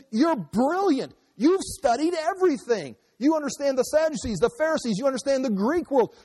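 high-pitched, incredulous imitation; exasperated exclaiming. Governor marveling at Paul's learning, mock exasperated exclaiming. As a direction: act out an astonished official, voice way up. Acted Festus exclaiming at Paul.

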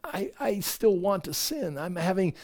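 soft, subdued acted admission; hushed confession. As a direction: confess it quietly, like a troubled believer.

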